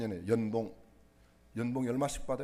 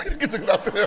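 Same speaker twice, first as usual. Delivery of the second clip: "이렇게 적어놨더래요" said through a loud laugh, higher and louder; 9 semitones higher, 10 dB louder, faster than usual. Retold or imitated. Preacher laughing at the daughter's note, laughing out the punchline. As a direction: burst out laughing while saying "이렇게".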